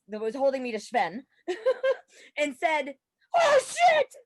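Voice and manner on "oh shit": loud high panicked yell; sudden frightened curse, full voice; startled yell. Retold or imitated. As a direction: shout it as you drop out of the plane. Jumping out, falling from plane.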